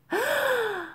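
high breathless gasp; gasping for air. A narrator's reading. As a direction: gasp as you surface. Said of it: "she gasped for air".

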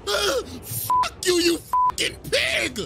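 hammed-up high shriek; mock screaming. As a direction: shriek the insult like a sore loser. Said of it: "started screaming and saying".

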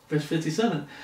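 normal talking voice

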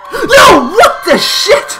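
very high, loud shriek of shock; startled scream. Real, not acted. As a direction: shriek in sudden fright. Jump scare.

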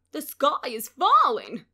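loud cry, stress on falling; alarmed cry. A narrator's reading. Henny Penny warns the others, crying out loud. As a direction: call out the alarm. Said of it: "cried Henny Penny".

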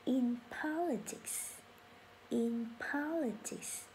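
very slow, syllable by syllable teacher read; slow model sentence. Shown as a demonstration. Teacher reading for class to repeat, very slow. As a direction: drag it out so learners can follow. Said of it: "phân tích tiếp".

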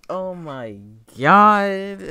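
loud, high, near tears; overcome. Real, not acted. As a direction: let it break out. Overwhelmed by the track.

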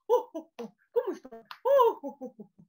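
hearty loud Santa laugh; Santa Claus ho ho ho. Shown as a demonstration. Teacher playing Santa for the class, loud and jolly. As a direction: boom it out from the diaphragm like Santa. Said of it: "jueguen a que son Santa Claus".

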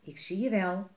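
ordinary delivery, nothing marked

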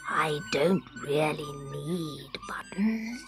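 soft, slow whispered kindness; kindly. Acted. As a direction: say it gently and kindly. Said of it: "said Scarecrow kindly".

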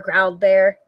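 usual speaking voice

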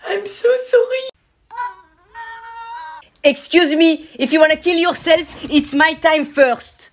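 very high, loud squeaky cartoon voice; a small creature scolding a friend; squeaky cartoon character.

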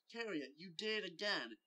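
mildly loud, exasperated scolding; angry exclamation. Acted. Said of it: "he exclaimed".

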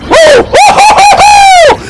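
loud, very high whooping; victory whoops. Real, not acted. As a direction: whoop in triumph. Victory cheer after kill.

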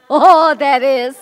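loud, slow, caught-off-guard oh; startled exclamation. Real, not acted. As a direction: gasp at what the example says. Reading a touchy example.

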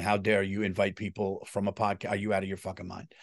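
ordinary talk, nothing marked

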